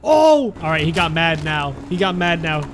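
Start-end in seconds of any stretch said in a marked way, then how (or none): 0.0-0.5 startled shout. Real, not acted. Reacting to game monster attack.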